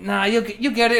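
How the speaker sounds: high, loud mock reassuring; breezy high "you'll get it"; mock reassurance. Reassuring friends mid-game.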